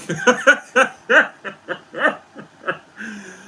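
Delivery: long, loud, high laughing fit; helpless laughter. Real, not acted. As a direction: laugh till out of breath. Cracks up at own toilet remark.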